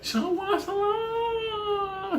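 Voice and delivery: very high, drawn-out, sing-song; mock greeting. Benny greeting the kung fu school, very high, slow and sing-song. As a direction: ham up a foreign greeting. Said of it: "he's in there like".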